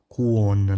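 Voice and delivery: slow, mistaken "cuona", as "ku-on-na"; "cuona" with the n split off; wrong pronunciation. Shown as a demonstration. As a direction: say "cuona" with a drawn-out "o" and sounded n. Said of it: "não, né, gente?".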